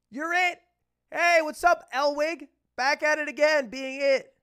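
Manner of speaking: high, loud jeering; mocking kids' taunt. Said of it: "They all made fun of me".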